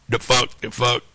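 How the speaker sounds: ordinary talk, nothing marked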